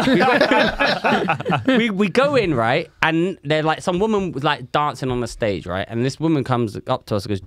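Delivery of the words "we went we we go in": laughing. Real, not acted. Laughing while telling.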